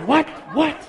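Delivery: high, abrupt, baffled yelps; mock bewildered outburst; startled dad, imitated. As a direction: act out a startled dad on the phone. Startled dad on phone, retold.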